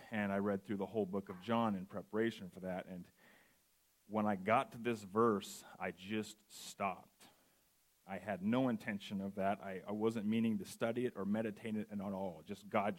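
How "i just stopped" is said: slow and heavy. Real, not acted. Sermon, recalling being stopped cold.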